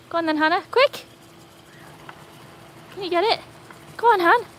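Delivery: high, eager calls to the dog; excited high cheering; urging the dog on. Real, not acted. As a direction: egg the dog on, quick. Urging dog to grab ball.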